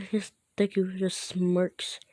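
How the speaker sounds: plain reading voice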